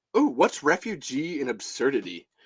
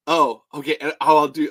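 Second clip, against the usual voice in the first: usual speaking voice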